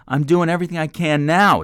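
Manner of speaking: very high, loud yell close to breaking; yelling near tears. A narrator's reading. Man yelling at the mentor, near tears. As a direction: yell with the voice about to crack. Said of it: "Troy yelled".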